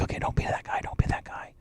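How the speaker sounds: hushed, voiceless whisper to himself; barely audible self-coaching; whispered self-talk. Retold or imitated. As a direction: whisper it like a private mantra. Retold nervous talk to himself.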